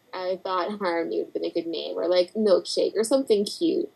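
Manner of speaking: usual speaking voice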